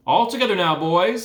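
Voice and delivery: loud call to the men. A narrator's reading. Calling to the men.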